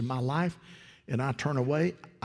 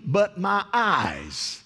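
higher, louder, drawn-out proclamation; slow preaching cry; 8 semitones higher, 4 dB louder, much slower than usual. Acted. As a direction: stretch each word out like a revelation. Preaching climax, slow and loud.

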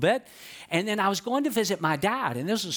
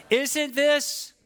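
high, loud "Isn't this?" for the crowd to echo; call and response; 10 semitones higher, 3 dB louder than usual. Shown as a demonstration. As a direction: lead the congregation in repeating "Isn't this?". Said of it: "Say it out loud".

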